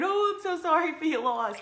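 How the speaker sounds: a light, high imitation of a gushing mourner; mock sympathy. Imitating tearful friends consoling a grieving mother, lightly mocking. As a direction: parody the well-meaning hugger, lightly. Mimicking people who hug her crying.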